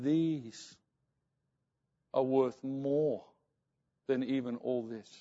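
soft and slow, a gentle rebuke; solemn quiet. Retold or imitated. The visiting pastor quietly rebukes the young pastor. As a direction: hold the rings up and speak it slowly and quietly.

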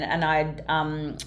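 normal talking voice

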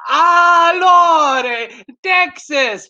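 loud, high, long-drawn whoop of delight; light up and shout it high, stretched out; excited Italian local. Retold or imitated. Italian man thrilled to meet a Texan, excited shout. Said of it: "his eyes lit up with excitement".

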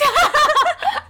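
high shrieking laugh; loud laughing burst. Real, not acted.

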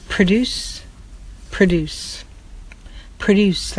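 produce stressed on DUCE, the first vowel weak; produce, second syllable stressed; verb produce. Shown as a demonstration. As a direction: say produce as pruh-DOOCE, to make. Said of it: "like you want to produce something".

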